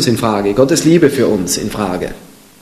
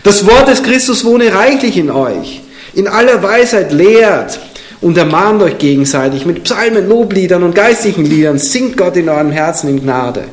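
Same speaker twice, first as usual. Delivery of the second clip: solemn, raised reading of a Bible verse; scripture reading; 5 semitones higher than usual. Acted. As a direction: proclaim the verse to the congregation. Reads scripture aloud.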